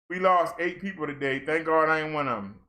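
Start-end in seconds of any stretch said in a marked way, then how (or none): none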